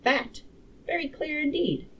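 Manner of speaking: put-on high-pitched young man's voice; lightly put-on youth, pitched well up. Young man chiming in on the lecture, high and youthful. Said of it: "said a very young man".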